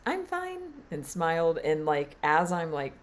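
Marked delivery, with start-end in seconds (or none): 0.1-0.6 put-on very high child's chirp; bright toddler brushing off a fall; cheerful little girl. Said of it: "and just was".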